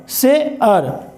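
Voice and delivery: Slow, stretched "से अर" with each part drawn long; slow drawn-out demo. Shown as a demonstration. Teacher slowly sounding out "से अर" for the class. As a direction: spell out "से अर" slowly for students.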